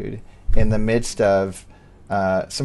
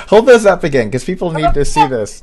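loud, higher burst of delight; excited; 5 semitones higher, 9 dB louder than usual. Real, not acted. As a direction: shout it out, thrilled by what you see. Host excited by her written notes.

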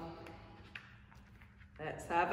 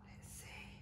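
whispered; 25 dB quieter than usual. Real, not acted. Before reveal.

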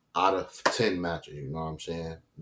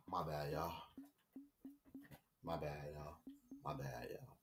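much softer, lower, sheepish; soft apology; 4 semitones lower, 17 dB quieter than usual. Real, not acted. Gamer apologizes softly to viewers after raging. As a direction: murmur the apology after the outburst.

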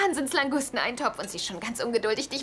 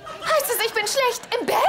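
upset accusation; 10 semitones higher than usual. Acted. Upset question in quarrel.

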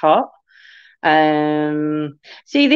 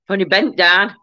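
breathless after bending down; puffing, out of breath. Real, not acted. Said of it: "I'm out of breath now".

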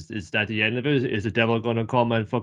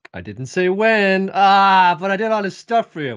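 higher and louder, slow, teasing demon; sly demon voice; 8 semitones higher, 7 dB louder, slower than usual. Acted. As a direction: stretch it out like a sneaky devil closing a deal. Demon bargaining, voiced by host.

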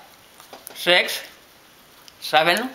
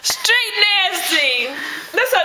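high, muffled, laughing; talking through a crammed mouth; cheeks stuffed full; 12 semitones higher than usual. Real, not acted.

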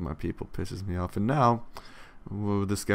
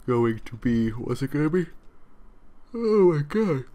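words swallowed by a big yawn, higher and louder; 7 semitones higher, 4 dB louder than usual. Real, not acted. Streamer fighting yawns while recording, yawning. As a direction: yawn right through it. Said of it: "Why am I yawning so much?".

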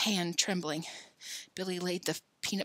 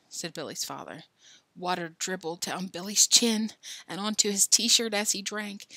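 plain reading voice

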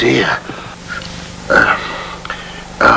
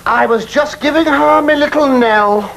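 flustered protest; 9 semitones higher than usual. Acted. Protests innocence to angry man.

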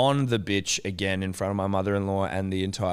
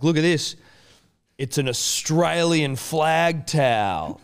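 higher, louder, drawn-out mock reveal; dad showing off; 6 semitones higher, 4 dB louder, slower than usual. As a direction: present it like a grand prize. Imitates dad showing off gift.

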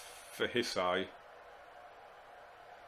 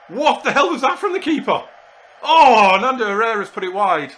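much higher and louder, yelling in disbelief; shocked shout; 9 semitones higher, 12 dB louder than usual. Real, not acted. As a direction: yell at the keeper's wild rush out. Wild goalmouth chaos and miss.

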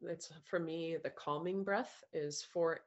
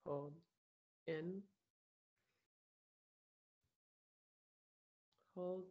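calm meditation voice, softer than chat, with long pauses; guided meditation; 4 dB quieter than usual. Acted. Coach guiding a group through breaths and self-love, calm meditation voice.

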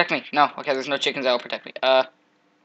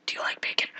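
whispered taunt; 9 dB quieter, faster than usual. Acted. Taunting pig.

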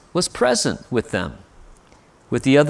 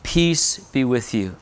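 gentle blessing; 4 semitones lower, 5 dB quieter, slower than usual. Acted.

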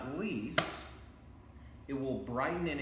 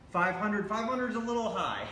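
laughing; 7 semitones higher than usual. Real, not acted. Laughs at a too-high guess.

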